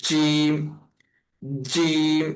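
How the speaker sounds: জিম said firmly, drawn out; জিম with a hard, held sound; firm articulation. Shown as a demonstration. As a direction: press firmly into জিম, tongue to palate. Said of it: "জিমটাকে শক্ত করে উচ্চারণ করার".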